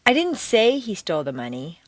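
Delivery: "say" lifted and emphasized; stress on SAY. Shown as a demonstration. Teacher indicating it was only suggested, stress on "say". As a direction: lean on "say" to imply only hinting. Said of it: "you didn't say it outright".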